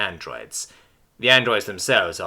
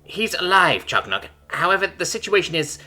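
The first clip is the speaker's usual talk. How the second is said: bittersweet; 8 semitones higher than usual. A narrator's reading. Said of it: "in a tone both shades of happiness and sorrow".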